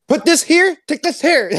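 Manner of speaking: mock sushi trainer yelling orders; imitating sushi trainer. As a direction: bark orders like the sushi lady. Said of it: "she would just yell at me".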